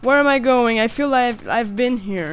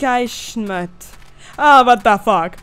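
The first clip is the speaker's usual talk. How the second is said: ordinary delivery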